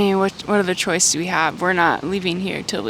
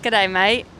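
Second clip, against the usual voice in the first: a playful Aussie "G'day, mate" with a broad "mate"; Australian accent. Pretend. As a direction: throw out a cheery Australian greeting. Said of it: "Let's hear your accent".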